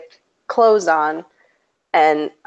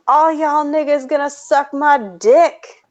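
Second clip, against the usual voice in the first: put-on higher-pitched, cocky retort in a child's role; mock child's retort; 8 semitones higher than usual. Child retorting to another child on the playground, mock defiant. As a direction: imitate a kid firing back at a classmate. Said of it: "this child chose to turn".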